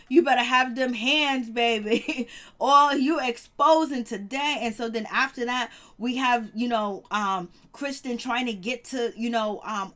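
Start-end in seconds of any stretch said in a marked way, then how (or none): 0.1-4.6 loud, sassy hype with drawn-out words; big playful call-out; hyped-up reaction. Real, not acted. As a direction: cheer on the troublemaker, loudly amused. Hyped mock reaction to the exposing.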